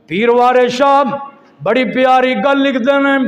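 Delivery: loud, high, ringing sermon delivery; fiery preaching. Acted. Preacher urging the congregation to pray, fiery preaching. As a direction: preach forcefully, driving each phrase out.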